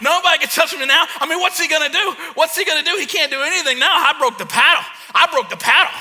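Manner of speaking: high, loud boasting; giddy, taunting victory brag; gloating kid. Retold or imitated. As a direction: crow like an untouchable kid. Acts out his boyhood gloating.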